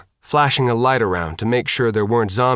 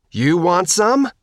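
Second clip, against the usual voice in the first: taunt; 8 semitones higher, slower than usual. A narrator's reading. Girl taunting zombies while shooting.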